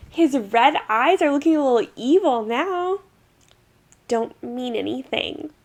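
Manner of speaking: ordinary talk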